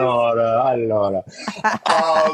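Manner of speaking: put-on playful, drawn-out Italian accent. Joking after the Italian boss impression, Italian accent. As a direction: stretch it out in a mock Italian accent. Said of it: "I'm gonna do it with the Italian accent".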